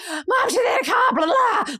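high-pitched garbled call to mum; hurried yell. Retold or imitated. Daughter yelling towards mum while tidying up, hurried yell. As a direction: yell it in passing, high and jumbled. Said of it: "sort of yelled it towards her".

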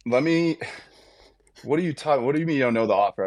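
start, break off, then fire the question fast; exasperated. Retold or imitated. Player to agent who doesn't know the offer, exasperated.

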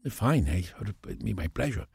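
soft, put-on Spanish accent; lightly accented Spanish-English, quietly obliging. Actor keeping up his Spanish accent for the producers, Spanish accent. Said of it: "speak to them only in the Spanish accent".

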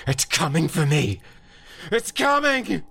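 high, piercing panicked scream; screaming in terror. Acted. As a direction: scream it as if it is right behind you. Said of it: "Carl screamed".

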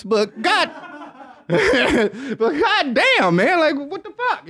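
high, drawn-out protest through laughter; amused, high-pitched incredulity; laughing exasperation. Real, not acted. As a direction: crack up while protesting in disbelief. Cracking up, mock exasperation.

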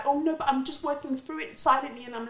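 parroting them in a prissy put-on voice. Mocking the people who say they learn silently, in a whiny put-on voice. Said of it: "it's just like".

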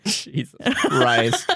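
laughing through the words, slowed right down; can barely get it out for laughing; real helpless laughter at the absurd text. Real, not acted. Laughing at the fan fiction.

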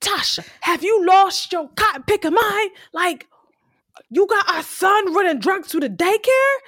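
high-pitched retold outrage; pissed off. Ghost confronting Tasha over their son, pissed. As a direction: imitate an upset man laying into her. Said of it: "So Ghost is pissed. He is upset".

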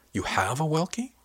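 amazed question. A narrator's reading. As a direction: gasp the question out in wonder. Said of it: "Sarah asked in amazement".

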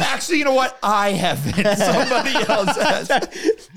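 high laughing correction. Real, not acted. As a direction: laugh it out while backpedaling.

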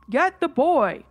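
loud, insistent call. Retold or imitated. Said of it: "It was much louder this time".

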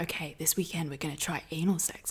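softly whispered plan. Retold or imitated. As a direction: whisper it conspiratorially. Quoting their plan.

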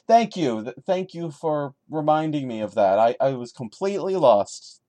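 sarcastic thanks. Real, not acted. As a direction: thank the writer with a sneer.

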